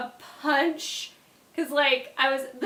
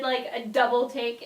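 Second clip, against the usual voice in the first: ordinary talk, nothing marked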